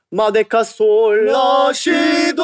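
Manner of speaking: slow, drawn-out title call; show title announcement. Acted. Host unveiling his podcast name, slow and grand.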